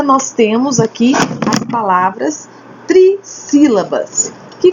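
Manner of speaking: usual speaking voice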